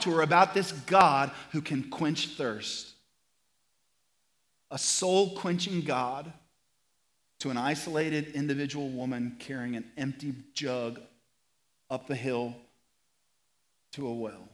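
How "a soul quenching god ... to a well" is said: hushed preaching. Acted. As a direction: draw the congregation in quietly. Softened, slow intimate preaching.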